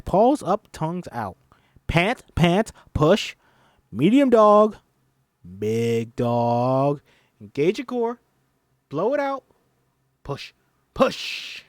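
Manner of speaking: high loud drilling coach. Acted. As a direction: drill the class. Drilling the exercise.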